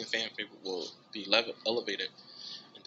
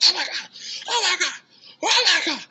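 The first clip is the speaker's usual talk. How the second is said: very high, loud, laughing oh my God; ecstatic squeal; 8 dB louder than usual. Retold or imitated. Reliving the grand prize news, high laughing shriek. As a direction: shriek it over and over in happy shock.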